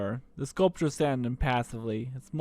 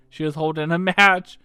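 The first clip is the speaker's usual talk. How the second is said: ordinary delivery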